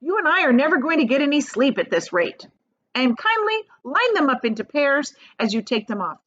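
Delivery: high, fussy scolding from the picky centipede. Acted. Centipede scolding James over his boots, high and fussy. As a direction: nag him to hurry with the boots. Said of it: "the centipede said".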